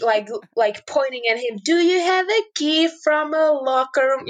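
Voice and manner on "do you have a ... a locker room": higher, every word spelled out as if for a foreigner; over-explain the question to a man you think knows no English; slow foreigner-talk. Retold or imitated. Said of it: "talking like this".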